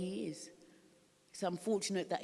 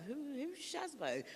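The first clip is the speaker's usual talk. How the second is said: indignant, higher and slow; offended mock question; 9 semitones higher, 5 dB quieter, slower than usual. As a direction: act out being offended at the nickname. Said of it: "I was so offended".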